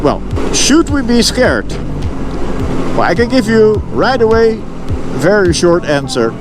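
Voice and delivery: ordinary talk, nothing marked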